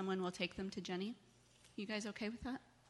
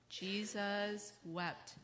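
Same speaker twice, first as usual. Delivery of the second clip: very slow, each word held apart; leading recitation; 2 semitones higher, much slower than usual. Shown as a demonstration. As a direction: recite it slowly so children can join in. Repeats verse one more time.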